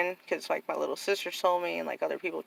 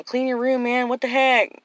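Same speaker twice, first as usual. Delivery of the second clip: higher, louder retold scolding; mock exasperated nagging; 6 semitones higher, 5 dB louder than usual. Big sister telling little sister to clean, mock exasperation. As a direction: nag in playful exasperation.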